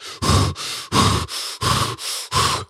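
forceful repeated puffs of breath; imitated hyperventilating. Man gripping his chair during the prayer, mimed hyperventilating. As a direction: mimic heavy panicked breathing. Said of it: "hyperventilating in his chair".

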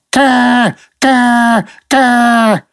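high cawing bird squawk. Retold or imitated. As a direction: squawk it like a crow outside the window. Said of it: "Every time I tried to talk".

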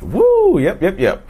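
high loud whoop; excited whoop. Real, not acted. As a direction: let out a big woo. Hype exclamation opening topic.

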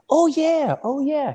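very high-pitched polite "oh yeah"; feigned recognition. Retold or imitated. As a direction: pretend to remember, voice shooting way up. Acts out feigned recognition of her.

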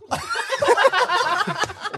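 high squealing laughter; loud high laugh. Real, not acted. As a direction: burst out laughing. Laughing after story.

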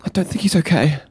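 high, fast, stammering worry; worried character voice. Acted. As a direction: stammer it out, scared for a friend. Trevor fearing friend is gone.

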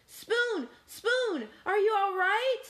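high, urgent calling; worried high-pitched storybook cry; alarmed concern. A narrator's reading. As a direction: call out anxiously to a hurt friend. Cat rushes to fallen friend.

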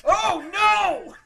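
high, loud mock horror shout. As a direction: cry out in hammy alarm. Dramatic reaction to narrated doom.

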